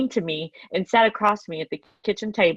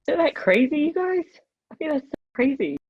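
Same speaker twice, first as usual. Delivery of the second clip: tearful, voice pitched high; talking through tears, catching breath; crying; 8 semitones higher than usual. Real, not acted. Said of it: "I'm sorry I'm crying so much".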